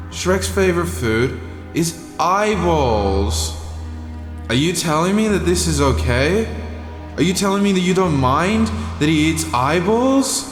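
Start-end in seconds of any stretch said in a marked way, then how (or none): none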